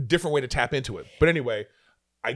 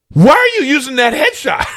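high, loud indignant shout with a laugh; 12 semitones higher, 10 dB louder than usual. Retold or imitated. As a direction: shout the question, laughing in outrage. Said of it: "I was so mad".

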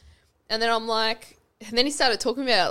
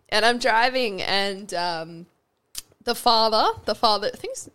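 ordinary talk

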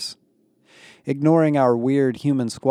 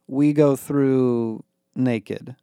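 very slow, drawn-out disbelieving question; suspicious; 2 semitones higher, 3 dB louder, much slower than usual. A narrator's reading. Becky questions going through the harbor naked, suspicious. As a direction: stretch it out warily, doubting what you heard.

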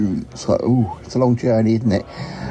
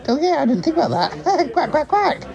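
high, squeaky amused voice; giggly realization; 14 semitones higher than usual. Pretend. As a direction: pitch jumps up with a giggle. Amused at own name pun.

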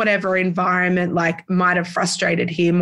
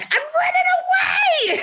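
put-on shrieking high child's yell, drawn out; comic kid shout; slower than usual. Boy storming off after mum hit him, high comic shout. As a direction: wail it like a furious little kid. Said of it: "he's like".

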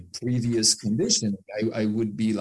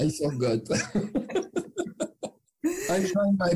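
laughing; 9 semitones higher, slower than usual. Real, not acted. Laughing about his French accent.

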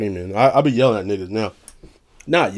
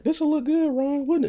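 very high falsetto wife voice; imitated wife. Wife dressing up her husband, in mock falsetto.

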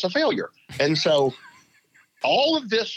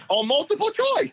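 laughing punchline. Real, not acted. Punchline after his own laugh.

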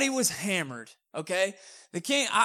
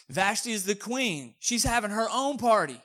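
normal talking voice

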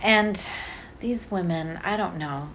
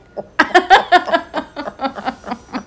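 laughing; 10 semitones higher, 7 dB louder than usual. Real, not acted. Laughing at her story.

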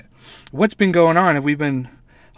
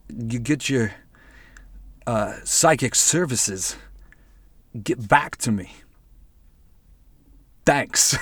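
slow, lower mock Walken drawl with odd pauses; Christopher Walken impression; 4 semitones lower, 5 dB quieter, slower than usual. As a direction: lightly imitate a strange movie-star caller. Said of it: "fucking Christopher Walken".

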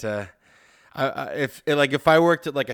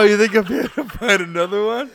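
higher, laughing mock retort; laughing sarcastic echo; 6 semitones higher than usual. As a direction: repeat the retort through laughter. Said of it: "Oh no".

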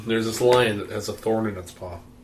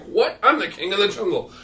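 high-pitched outraged protest; acted indignation, pitch jumping up; indignant lion; 9 semitones higher, 4 dB louder than usual. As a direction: protest as an affronted king. Lion objects to monkey's deal.

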